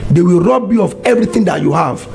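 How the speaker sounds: usual speaking voice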